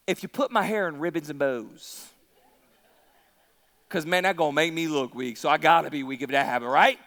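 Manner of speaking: low mumbly confession, speeding up; low, subdued mock give-in; reluctant Samson. Preacher plays Samson confessing about his hair, low and reluctant.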